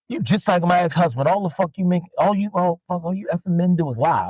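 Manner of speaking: high-pitched mocking of an angry woman; mock furious rant. Angry customer yelling at seller about her ex-husband, mock furious. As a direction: ham up her shrill accusation. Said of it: "The lady was like".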